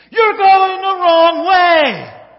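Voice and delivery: very loud, high, drawn-out holler; full yell. Acted. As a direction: yell a warning across the highway. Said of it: "yells over to John Candy".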